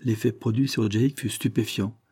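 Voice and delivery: usual speaking voice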